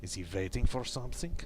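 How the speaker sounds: a lightly done fake Eastern European accent reading the diary; bad Russian accent. Gamer reading crew member Pavel's diary entries aloud, in a light fake Russian accent. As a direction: read the log in a hammy Russian accent, only a hint of it. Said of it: "my bad, fake, slightly racist Russian slash Eastern European accent".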